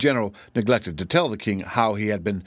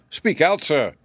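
high-pitched royal order; commanding; 6 semitones higher, 3 dB louder, slower than usual. A narrator's reading. Astonished king to his general, commanding. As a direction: demand an answer at once. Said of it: "he commanded".

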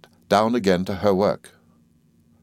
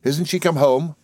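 put-on higher, rueful child's question; mildly rueful, rising at the end; a touch of rue in a boy's voice; 7 semitones higher than usual. A narrator's reading. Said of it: "I said ruefully".

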